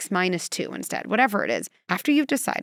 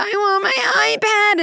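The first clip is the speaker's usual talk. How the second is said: high, loud, slow whine; whiny kid; 13 semitones higher, 7 dB louder, slower than usual. Retold or imitated. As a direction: drag it out like a child begging. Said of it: "to tolerate the whines".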